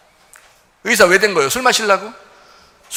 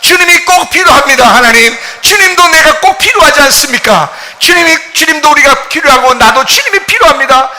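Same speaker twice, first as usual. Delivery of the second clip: builds from plain to a higher, loud shouted plea; model prayer; 9 semitones higher, 11 dB louder than usual. Shown as a demonstration. Pastor shows the congregation how to pray, building to shouting. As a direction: cry out to God, louder with each line. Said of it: "기도할 때".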